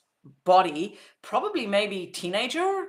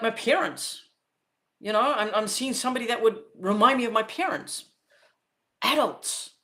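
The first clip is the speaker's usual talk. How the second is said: ordinary talk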